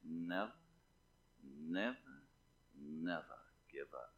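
attempted British statesman, very low and soft; Winston Churchill. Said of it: "I've tried to imitate him so many times".

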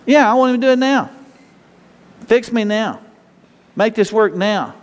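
high-pitched, impatient demands; mock exasperation, demanding it immediately; ticked-off demand. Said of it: "kinda ticked off at Him 'cause".

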